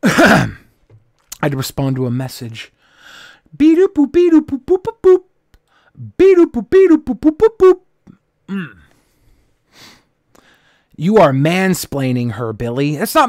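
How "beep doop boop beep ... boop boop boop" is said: high chirpy beep-boop chant; high beeping noises. Pretend. Singing robot noises.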